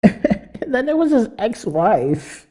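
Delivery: high, drawn out, breaking into laughter; laughing. Real, not acted. Host cracking up about a politician's ex-wife, laughing. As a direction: talk through a rising laugh.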